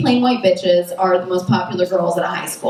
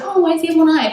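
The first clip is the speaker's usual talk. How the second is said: curious high voice; 8 semitones higher, faster than usual. Retold or imitated. To herself at the one-eyed dog, high and curious. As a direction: pitch it up high in mock curiosity. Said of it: "I'd be like".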